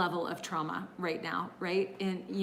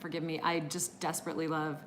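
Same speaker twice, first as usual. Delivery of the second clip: ordinary talk, nothing marked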